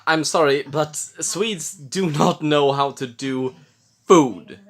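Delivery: usual speaking voice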